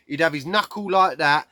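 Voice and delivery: normal talking voice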